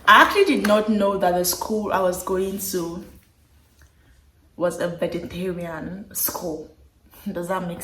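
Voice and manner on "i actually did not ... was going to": ordinary delivery, nothing marked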